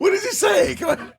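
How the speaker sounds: very high comic shout; high-pitched mock exasperation; exasperated whale. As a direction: squeal it as a baffled whale. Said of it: "The whales are looking at each other".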